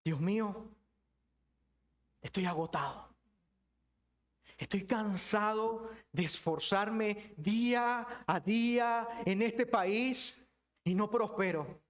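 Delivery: slow, drawn-out complaint to God; weary lament. Acted. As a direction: drag the words out as if worn down. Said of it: "¿Cómo suena tu lamento".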